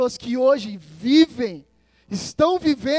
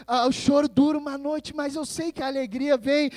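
ordinary talk, nothing marked

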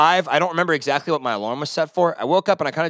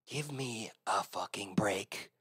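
slow, breathy under-the-breath whisper; whispered retort; 7 semitones lower, 15 dB quieter, slower than usual. Retold or imitated. Grumbling to himself about the woman, whispered slowly. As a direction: hiss it to yourself.

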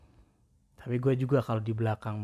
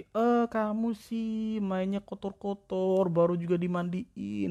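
slow, higher, mild scolding of a cat; slow mock scolding; 7 semitones higher, slower than usual. Owner scolding a freshly bathed dirty cat, slow and mild. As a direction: scold the cat slowly like a child.

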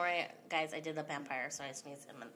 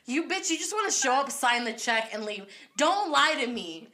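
mock accusing shout; loud; 5 semitones higher, 7 dB louder than usual. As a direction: call her out loudly. Playful accusation of friend.

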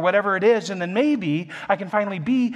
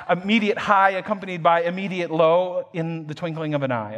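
usual speaking voice

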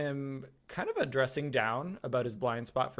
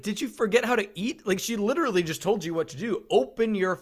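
raised, mock-outraged telling-off; comic rant, exasperated and louder at the end; exasperated scolding; 6 semitones higher, 7 dB louder than usual. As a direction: build to a loud, high demand. Scolds the actor about eating.

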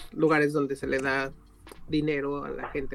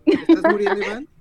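much higher, louder joking panic; mock alarm; 13 semitones higher, 11 dB louder than usual. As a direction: squeal it in fake alarm. Mock concern at Iván.

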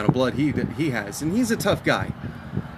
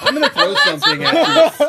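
loud, higher, laughing threat; laughing mock threat; 7 dB louder than usual. Real, not acted. Playfully threatening her friend over his cheap beer, loud and laughing. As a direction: shout it playfully through laughter.

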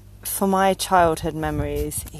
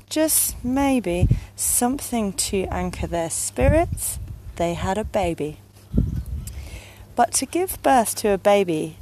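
storytelling verse with lifted line openings; poem reading; 3 semitones higher than usual. Acted. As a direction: read it as a dark little tale in rhyme. Reads a Tim Burton poem.

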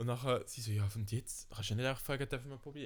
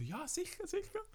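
mock eager shop lady, much higher; hammed-up sure-sure; eager saleswoman. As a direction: chirp it like a keen shop lady. Saleswoman's reply retold.

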